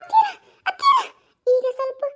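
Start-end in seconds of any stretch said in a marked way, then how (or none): 0.0-1.0 high, loud anxious calling; loud worried call to her mother-in-law; alarmed call. Acted.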